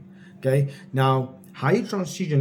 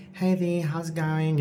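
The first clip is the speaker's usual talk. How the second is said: low-energy greeting; 3 semitones higher, 4 dB quieter, slower than usual. Shown as a demonstration. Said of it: "You can't have that kind of voice tone".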